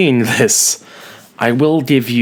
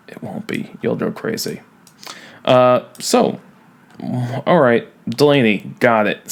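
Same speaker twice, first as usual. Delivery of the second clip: usual speaking voice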